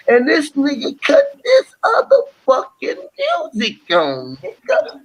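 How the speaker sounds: hammed-up whiny outrage; mock whining. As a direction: whine like he ruined your night.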